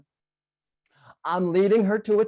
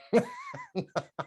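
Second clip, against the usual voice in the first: laugh; 5 dB quieter than usual. Real, not acted. Laughs at the bet.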